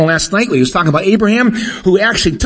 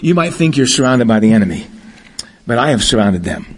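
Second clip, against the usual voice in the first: voice of the Lord; 9 semitones lower than usual. Acted. Preacher quoting the Lord again.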